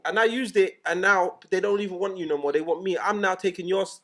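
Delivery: loud, high, forceful gloating; acted boast. Rival DJ telling the mixer he is replaced, acted loud boast.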